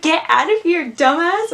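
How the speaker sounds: high, loud comic scolding; laughing mock outburst; mock angry yell. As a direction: yell it as a joking telling-off. Mock holocron throwing him out.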